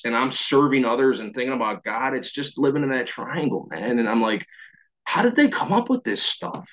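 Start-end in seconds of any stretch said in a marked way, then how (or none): 5.0-6.7 much higher, wondering question; mock amazement. Man marvelling at the program's ideas, amazed. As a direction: marvel with a high-pitched voice.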